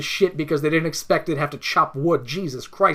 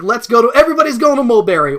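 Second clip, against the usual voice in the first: higher and louder, eager call to everyone; mock excited announcement; 6 semitones higher, 9 dB louder, faster than usual. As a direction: cheerily announce a group trip. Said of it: "where it's just like".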